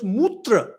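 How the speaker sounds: Mutra, said MOOT-ra in two syllables; English spelling pronunciation. Shown as a demonstration. Showing what reading the spelling in English gives, misread Mutra. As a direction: read Mutra literally with an oo. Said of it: "if you read in English, it becomes".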